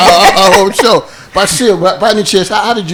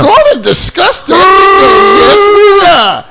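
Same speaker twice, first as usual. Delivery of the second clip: hyped announcer; 12 semitones higher, 5 dB louder than usual. Acted. Host hypes show topic.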